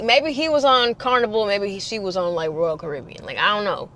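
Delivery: ordinary delivery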